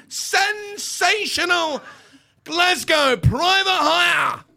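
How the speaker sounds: hammed-up Ian Crocker announcer voice. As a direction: boom it out like a big football commentator. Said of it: "in the style of Ian Crocker".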